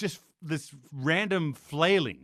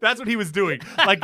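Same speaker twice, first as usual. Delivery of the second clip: laughing; 5 semitones higher, 5 dB louder than usual. Real, not acted. Laughing describing conductor.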